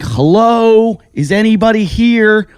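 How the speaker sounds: calling out loud and high into an empty shop; mock yell for anyone there; retold yelling. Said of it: "I'm yelling and screaming".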